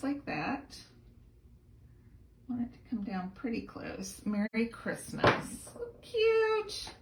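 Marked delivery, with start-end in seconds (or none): 6.0-6.9 high delighted squeal of cute; little high-pitched gush. Real, not acted. Admiring finished stamping.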